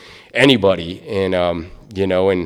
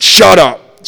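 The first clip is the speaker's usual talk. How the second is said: much higher, louder snap; sharp shut up; 8 semitones higher, 12 dB louder than usual. Retold or imitated. DOT lady silencing the speaker, high and loud. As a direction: cut him off loudly. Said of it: "and she said".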